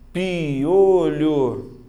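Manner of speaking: "piolho" drawn out loudly as pi-o-lho; syllable separation. Shown as a demonstration. Teacher counts three vowels for students, "piolho" stretched syllable by syllable. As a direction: sound out "piolho" in three long, joined syllables. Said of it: "tem que saber separar".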